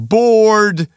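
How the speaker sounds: loud, high sustained yell; retold heckler scream. Misheard guest shouting through the speeches, imitated scream. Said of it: "trodde att någon som skrek".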